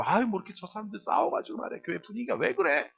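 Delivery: high, softened complaint; mock grumbling. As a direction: imitate a disapproving bystander. Acted grumbling about quarrelling members.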